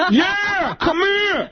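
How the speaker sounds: very high, insistent mimicked call; Tracy Morgan calling out. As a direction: summon someone in a high comic impression. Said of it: "he goes".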